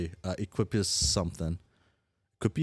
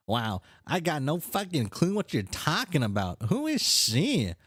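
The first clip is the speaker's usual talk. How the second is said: higher voice, incredulous and mouthy; brash, baffled character; 8 semitones higher, slower than usual. Acted. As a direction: play a cursing troll who is baffled.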